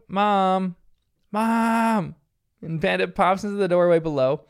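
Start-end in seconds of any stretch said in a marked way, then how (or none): 0.1-2.1 drawn-out, higher-pitched call for mom; mock child's yell. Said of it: "she yells out".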